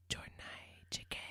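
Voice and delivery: hushed whisper. Pretend. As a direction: breathe it, barely voiced. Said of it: "Did you whisper to him?".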